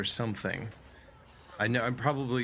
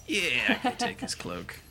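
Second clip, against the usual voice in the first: much higher, eager realization; high character voice; 11 semitones higher than usual. Acted. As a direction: perk up, pitch jumps up. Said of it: "She's like".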